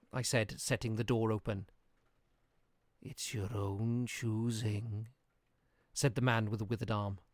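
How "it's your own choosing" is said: put-on slow, soft grim old man. A narrator's reading. Old man, withered arm.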